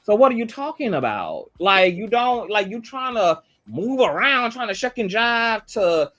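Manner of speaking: exasperated scolding of a guest; heated rebuke. Real, not acted. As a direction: press him hard, voice climbing. Heated rant at Wiggins.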